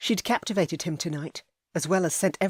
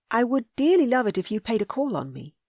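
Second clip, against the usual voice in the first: put-on higher, hopeful young woman; young lady voice; 6 semitones higher, 4 dB louder than usual. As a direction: ask him sweetly.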